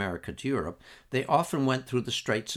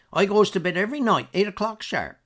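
put-on higher, quick and firm old man; lightly voiced set-in-his-ways old man; old Uncle George; 9 semitones higher, 5 dB louder than usual. A narrator's reading. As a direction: state it proudly like a stubborn old man. Old uncle states bedtime.

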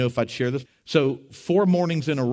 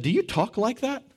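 higher-pitched retold disbelief; astonished question; 9 semitones higher than usual. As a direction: ask it high, as if amazed.

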